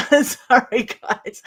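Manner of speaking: embarrassed laugh. Real, not acted. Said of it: "I'm a little bit frustrated in myself".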